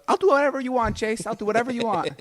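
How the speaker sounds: high, sweet mock-mom voice; hammed-up high mother voice; imitating mom. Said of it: "And she goes".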